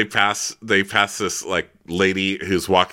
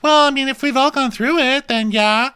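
much higher, petulant; whiny justification; 6 dB louder than usual. Retold or imitated. Brandy excusing her joke, high whiny voice. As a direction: whine the excuse. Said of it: "She goes".

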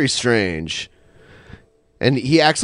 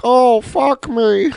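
slow moaning; 9 semitones higher, much slower than usual. Retold or imitated. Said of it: "Egghead's like".

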